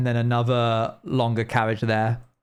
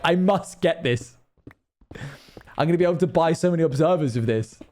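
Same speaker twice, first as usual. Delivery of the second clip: greedy excitement; 5 semitones higher than usual. Retold or imitated. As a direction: play a gold-crazed miner grabbing treasure. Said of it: "I literally just went".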